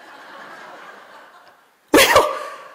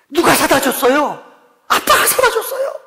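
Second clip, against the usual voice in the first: exaggerated question; 9 semitones higher, 3 dB louder than usual. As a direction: ham it up, pitching the answer very high. Preacher quizzing, exaggerated. (과장된 높은 목소리)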